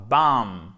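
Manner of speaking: BAM ending on a held M, no final B; B final muet. Shown as a demonstration. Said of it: "il ne se prononce pas". (Bam sans prononcer le B final)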